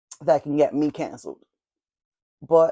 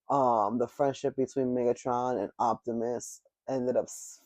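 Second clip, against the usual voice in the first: ordinary delivery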